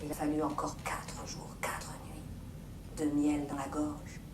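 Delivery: soft storyteller whisper; hushed narration. A narrator's reading. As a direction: let time pass in a whisper. Hushed passing of time.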